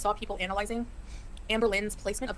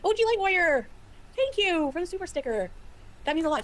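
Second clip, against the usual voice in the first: much higher, slow, sugary Canadian-style thanks; sugary thank-you voice; 8 semitones higher, 4 dB louder than usual. Acted. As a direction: coo thanks to a donor like a little girl. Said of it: "Stop talking like that".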